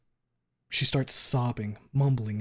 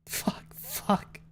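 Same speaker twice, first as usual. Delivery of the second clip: tearful whisper; 3 dB quieter than usual. Acted. Fang crying before hanging up.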